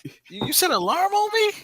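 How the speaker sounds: loud, high-pitched playful disbelief; mock offense. A friend confronting him over the alarm, mock offended. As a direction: play the betrayed friend, voice shooting up.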